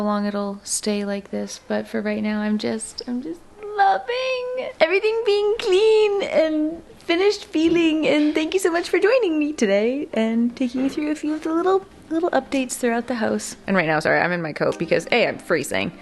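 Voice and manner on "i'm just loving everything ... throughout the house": higher, slowed-down gushing. Real, not acted. Vlogger thanking viewers in her clean house, gushing higher. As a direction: coo over the clean house.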